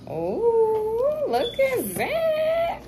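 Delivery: very high, slow sing-song; high cooing voice. Real, not acted. Mom cooing at baby over flowers.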